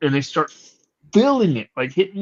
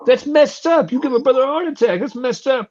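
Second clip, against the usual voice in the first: relieved; 10 semitones higher than usual. Retold or imitated. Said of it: "they're like".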